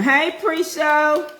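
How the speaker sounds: high, loud, drawn-out greeting; calling out. Real, not acted. Vlogger greeting a viewer mid-stream, called out high. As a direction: sing out a hello, loud and stretched.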